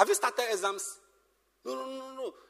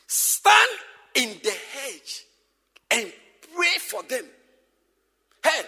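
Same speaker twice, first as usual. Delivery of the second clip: ordinary delivery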